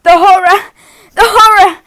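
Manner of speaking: loud, high anguished wail. Acted. Mother covered in filth, loud high wail. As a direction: wail it over the ruined mess. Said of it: "she wailed".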